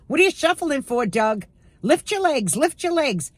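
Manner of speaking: high, insistent nag at husband; nagging yell. Retold or imitated. Wife yelling at retired husband to lift his legs, high nag. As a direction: pitch up and nag him to lift his feet. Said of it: "I yell at him sometimes".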